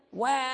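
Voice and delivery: high shouted newborn wah; mock baby wail. Preacher to children, mimicking a newborn's first wail.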